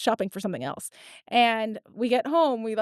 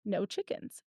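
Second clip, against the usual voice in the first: ordinary delivery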